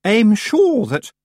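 high, loud, drawn-out grand lady; chilly politeness. A narrator's reading. Missus Earwig answers Granny, chilly politeness. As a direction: begin grandly with formal condescension. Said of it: "formal and chilly way".

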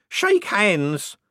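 high, loud, drawn-out punchline; triumphant shriek. A narrator's reading. Tipsy aunt crowing her joke's answer, triumphant shriek. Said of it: "she'd triumphantly shriek".

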